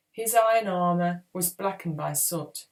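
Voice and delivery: ordinary delivery, nothing marked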